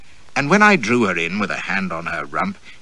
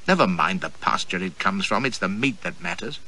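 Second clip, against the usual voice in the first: ordinary talk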